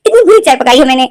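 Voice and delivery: high, loud hurt protest; offended son. Retold or imitated. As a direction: whine that she laughs at him. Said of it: "वो बोलते हैं".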